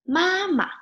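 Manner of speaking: "妈妈" high and held, then a drop; model pronunciation. Shown as a demonstration. Teacher modelling mom for learners, model pronunciation. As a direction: lift the first syllable of "妈妈" well up.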